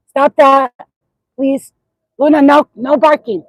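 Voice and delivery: telling her barking dog to stop, loud and firm; scolding the dog. Real, not acted.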